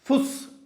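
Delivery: teacher demonstrating German फुस; German pronunciation. Shown as a demonstration. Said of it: "टी के स्थान पर बन गया".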